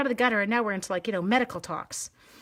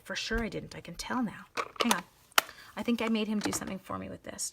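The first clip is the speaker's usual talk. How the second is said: usual speaking voice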